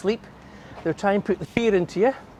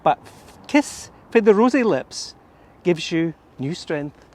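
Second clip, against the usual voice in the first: louder, recited nursery rhyme in thick Scots; reciting in broad Scots; 2 semitones higher, 4 dB louder than usual. Shown as a demonstration. Guide reading the Scots rhyme to viewers, broad Scots recitation. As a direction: perform the Scots poem with relish. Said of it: "Scots first".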